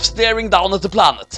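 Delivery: ordinary delivery, nothing marked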